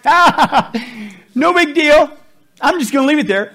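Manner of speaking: high, loud, carefree brush-off; breezy dismissal. Pretend. Preacher acting out a careless churchgoer, loud and dismissive. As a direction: shrug it off like nothing's there. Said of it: "you go like".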